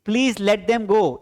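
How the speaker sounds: very slow, word by word, for the class; slow model sentence. Shown as a demonstration. Teacher dictating an English sentence to students, very slowly. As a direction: spell each English word out slowly for learners. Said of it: "कृपया उनको जाने दीजिए".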